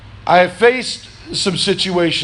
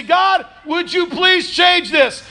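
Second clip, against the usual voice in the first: loud pleading prayer; 12 semitones higher, slower than usual. Pretend. Preacher voicing a congregant's prayer, loud slow plea. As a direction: cry it out high and slow, pleading. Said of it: "You look at it all and you say".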